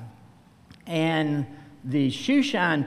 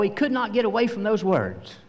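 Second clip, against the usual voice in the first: usual speaking voice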